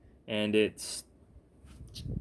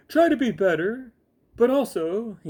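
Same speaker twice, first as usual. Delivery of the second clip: much higher, louder sing-song parody of his own advice; mock preachy voice; 11 semitones higher, 5 dB louder than usual. Vlogger mocking his own advice to viewers, squeaky preachy parody. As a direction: send up your own sermon in a squeaky preachy voice. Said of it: "I'm such a jackass".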